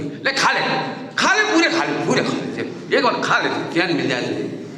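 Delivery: hammed-up scolding mother; mock angry mother. Mother slams the plate before the child, mock angry.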